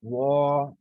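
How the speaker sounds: "वा" with lips rounded, heavy. Shown as a demonstration. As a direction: round the mouth and say "वा". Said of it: "इसको मुँह को गोल करके". (होंठ गोल करके भारी "वा")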